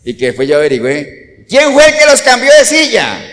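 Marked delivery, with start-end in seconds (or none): 1.5-3.2 raised, pointed question to the congregation; a loud accusing question; loud demanding question. Retold or imitated. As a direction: demand to know who did it, loudly. Pastor retells asking who moved them.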